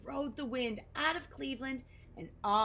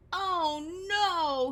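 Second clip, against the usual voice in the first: higher, louder "Oh no!"; dismayed cry; 9 semitones higher, 8 dB louder than usual. Acted. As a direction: Oscar crying out at the mirror. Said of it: "he cried".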